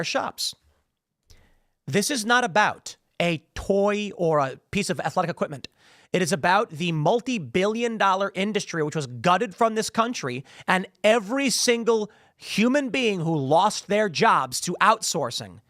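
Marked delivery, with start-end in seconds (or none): none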